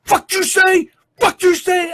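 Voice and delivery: retold high, loud tough-guy shout at the geese; tough guy yelling. A passerby yelling at a family of geese, mock tough-guy shout. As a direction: bark it at the birds like a street tough. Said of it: "really tough guy thing every time he says something".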